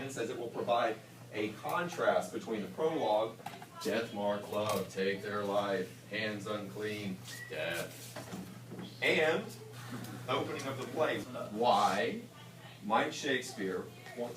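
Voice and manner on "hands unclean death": usual speaking voice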